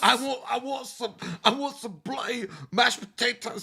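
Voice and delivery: put-on high, loud Scottish drunk voice; hammy Scottish accent ordering food. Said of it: "a really drunk guy at Taco Bell".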